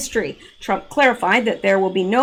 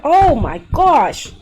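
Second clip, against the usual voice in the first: loud pained outburst mid-cramp; 5 semitones higher, 6 dB louder, much slower than usual. Real, not acted. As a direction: cry out through a foot cramp. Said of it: "I got a foot cramp".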